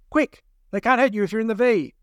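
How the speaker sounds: high, loud urgent call; urgent shout. Acted. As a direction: shout it over gunfire. Eve urging under fire.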